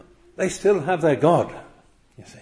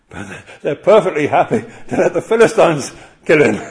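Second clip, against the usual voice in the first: laughing in disbelief; 3 semitones higher, 6 dB louder than usual. Real, not acted.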